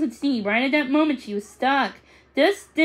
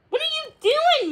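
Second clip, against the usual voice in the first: high, startled question; 14 semitones higher than usual. Acted. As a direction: ask it as a startled boy. Charles startled by Max.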